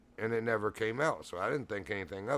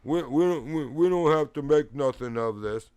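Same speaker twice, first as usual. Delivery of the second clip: louder, higher stammered retreat; flustered, raised-voice backpedaling; imitated shaky backing down; 5 semitones higher, 8 dB louder than usual. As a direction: imitate a rattled man stammering on "we". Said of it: "he was all shaking".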